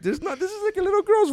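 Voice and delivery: very high retold disbelief; incredulous squeal.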